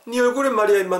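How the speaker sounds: high-pitched dialect insistence; insistent friend. Acted. Friend pointing out the bruise, high and insistent.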